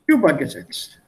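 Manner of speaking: "übergesetzt" stressed on "über", falling after; stress on first syllable. Shown as a demonstration. Teacher demonstrating the first-syllable stress of "übergesetzt" for the student. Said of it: "I put the stress on the first one".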